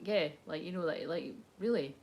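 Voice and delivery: low, hushed retelling of her answer; soft intimate reply. Retold or imitated. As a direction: keep it very quiet and tentative. Retold intimate film dialogue.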